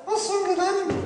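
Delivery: high ventriloquist's puppet voice; puppet repeating. Acted. Puppet copying the ventriloquist's greeting to the ladies, high puppet voice. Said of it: "Tu vas répéter après moi".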